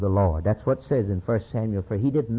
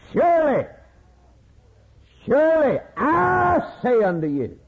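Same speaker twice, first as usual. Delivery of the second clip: high, loud preacher's proclamation; emphatic preaching; 14 semitones higher, 4 dB louder than usual. Shown as a demonstration. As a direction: ring it out with full force to the congregation. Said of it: "you can't miss this one".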